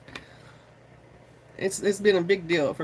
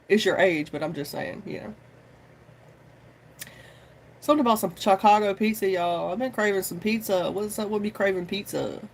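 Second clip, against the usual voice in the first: ordinary delivery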